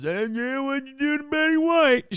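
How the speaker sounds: high, accusing mock fan voice; mock audience. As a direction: needle him like a smug fan. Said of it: "our audience goes".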